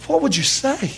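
high-pitched, wondering question; high, awed question. Acted. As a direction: ask it high, as if astounded. Imagined meeting with Apostle Paul.

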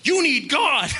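high, hammed-up shout; mock preacher's cry. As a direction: bellow it as a comic self-parody. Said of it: "would just walk around, you know, like".

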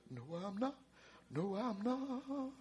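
very soft, trailing sing-song; hushed refusal. Acted. Preacher acts the woman leaving her chores, refusal hushed. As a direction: let the defiance fade almost to nothing.

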